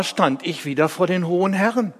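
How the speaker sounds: ordinary delivery, nothing marked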